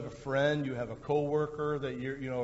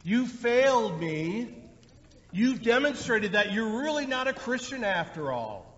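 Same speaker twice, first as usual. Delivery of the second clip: mock harsh scolding; 6 semitones higher, 4 dB louder than usual. Christ scolding a failed believer, harsh mock rebuke. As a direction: scold like a disappointed judge. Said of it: "looking down on you and saying".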